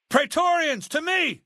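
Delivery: high, loud commanding call; calling guards. Acted. Leader calls his Praetorian guards, loud and high. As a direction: summon the guards at full voice.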